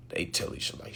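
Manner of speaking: a breathy whisper. Real, not acted. Whispering so his daughter won't catch it. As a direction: whisper it so nobody hears. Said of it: "I gotta be quiet".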